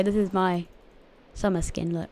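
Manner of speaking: ordinary talk, nothing marked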